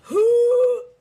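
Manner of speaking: delighted gasp. Real, not acted. Reacting to a cute cat.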